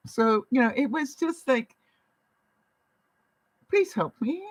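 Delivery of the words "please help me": high, small begging voice; imitated plea, pitched well up; mock pleading. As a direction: plead for help, playfully helpless. Retold plea to customer service.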